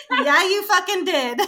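loud, high comeback through laughter; laughing retort. Retold or imitated. Retorting to the sound guy who heard it, laughing. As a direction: call them out while cracking up.

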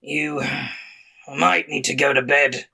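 rough orc boss giving advice; gravelly foreman voice; gravelly half-orc. Acted. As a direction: growl it at a worn-out worker. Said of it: "sometimes the gravelly voice takes a lot".

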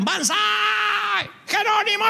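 high shouted war cry; mock battle cry. The three warriors charging in, mock shouting. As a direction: yell it like charging soldiers. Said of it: "significa que gritaron".

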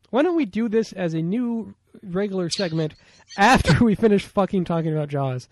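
ordinary talk

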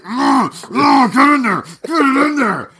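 high, loud grunting strain; squealed, straining mock grunts; strained effort noises. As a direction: act out shoving something in with effort. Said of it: "when it's just like".

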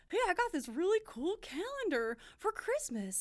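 high, swooping mock voice; imitating co-host. Host mimicking her co-host's message about the calendar, teasing imitation. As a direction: put on a cartoonish, high-pitched version of a friend. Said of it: "Is that what I sound like".

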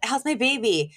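high, urgent imitation of a worried mother; anxious patient. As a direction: plead with the doctor, pitched high. Acting out anxious patient greeting doctor.

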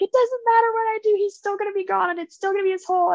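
high, imitated tearful lament; retold tearful outburst. Daughter runs to her mom in the bar, high mock-tearful voice. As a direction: pitch way up, as if wailing to mom. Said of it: "crying and she's like".

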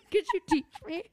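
high laughter breaking through the words; laughing. Real, not acted. Joking about the show with her cohost, laughing hard. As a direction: crack up mid-line, squeaky with laughter. Cracking up while quoting.

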